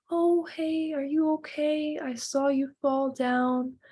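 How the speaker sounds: calm, present. Acted. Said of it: "you're being calm".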